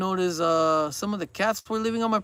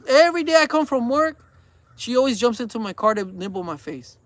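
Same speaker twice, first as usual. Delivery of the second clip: ordinary talk, nothing marked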